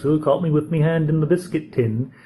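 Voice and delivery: playful mock English accent; Daphne's English accent. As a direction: lightly imitate Daphne's exaggerated English accent. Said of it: "Her accent is much more exaggerated and sort of comical".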